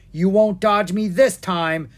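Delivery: loud, high, drawn-out threat; threatening. A narrator's reading. Wizard aiming his wand at dragons, threatening. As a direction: threaten loudly, taking your time.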